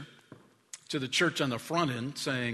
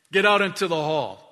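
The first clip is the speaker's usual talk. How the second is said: lightly acted stern order, louder and higher; tough ex-Marine teacher; 4 semitones higher, 7 dB louder than usual. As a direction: order a pupil out, mock-tough. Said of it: "the dude was tough".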